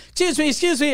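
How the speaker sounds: high-pitched imitation of a waitress; waitress calling out. Waitress stops fleeing customer, imitated calling out. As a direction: pitch it up to flag someone down. Waitress stopping him, retold.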